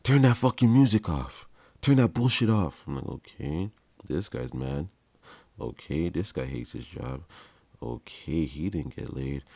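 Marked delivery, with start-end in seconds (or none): 0.0-2.8 angry bark, higher and louder; a cop yelling at kids to kill the music; aggressive cop. Retold or imitated. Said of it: "he came up so aggressive". 3.1-9.4 soft, muttered private thoughts with rising okays; thinking to himself under his breath; inner thoughts. Retold or imitated. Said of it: "That's what I'm thinking in my head".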